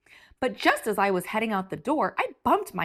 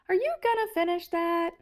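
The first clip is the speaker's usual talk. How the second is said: sweet little voice; 8 semitones higher, faster than usual. Acted. Sweet grape voice interjection.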